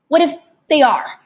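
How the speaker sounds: plain reading voice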